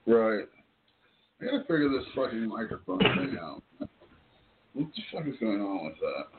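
usual speaking voice

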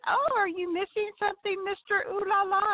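high, sing-song taunting; high teasing mock-innocence. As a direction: tease him sweetly and high. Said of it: "I said".

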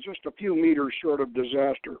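ordinary delivery

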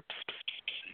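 whispered psst psst; imitated psst. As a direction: hiss a spirit's secret psst. Imitating a spirit voice.

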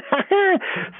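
high-pitched gloating laugh. Retold or imitated. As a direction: laugh it up as the smug player.

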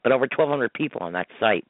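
usual speaking voice

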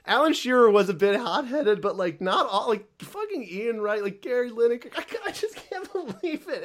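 high, breaking into giggles; real helpless laughter; laughing. Real, not acted. Cracking up over fan meltdown.

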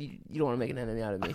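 low, hushed, quick retold warning; quiet threat. Retelling Stephen A's enemy warning, low and quiet. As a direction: drop low and soft for the quote. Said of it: "he got real raspy".

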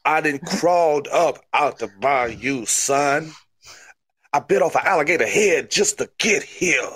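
hammed-up Cajun promo voice; crazy Cajun. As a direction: boast like a bayou wrestler in Cajun accent. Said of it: "You're a crazy Cajun".

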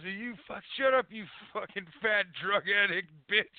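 ordinary talk

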